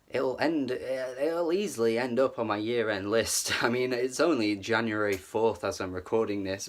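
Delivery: ordinary talk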